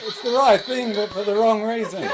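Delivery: laughing through the punchline, high and loud; giggling punchline repeat. Acted. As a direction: crack up while repeating the line.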